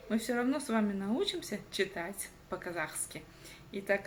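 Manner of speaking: ordinary talk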